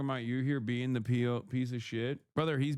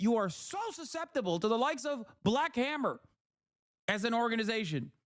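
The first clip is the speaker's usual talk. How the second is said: exasperated rant; 7 semitones higher than usual. Real, not acted. Ranting at chat.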